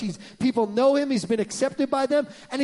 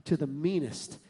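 low, hushed scheming wife; a hint of a sly whispering wife; wife plotting revenge on her husband, hushed; conspiratorial lady; 7 semitones lower, 6 dB quieter than usual. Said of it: "She said".

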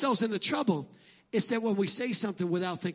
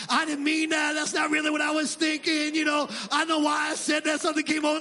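loud, higher, hurried backpedaling; flustered mock excuse; 6 semitones higher, 3 dB louder than usual. Pastor acting out a guilty excuse, hammed-up and loud. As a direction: scramble to take it back.

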